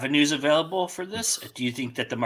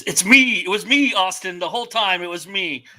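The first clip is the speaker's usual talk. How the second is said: wrestling heel reveal; 7 semitones higher, 4 dB louder than usual. Retold or imitated. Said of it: "And he's".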